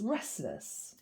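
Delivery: restless, dropped to a near-murmur; a quiet afterthought of restless; soft echo. A narrator's reading.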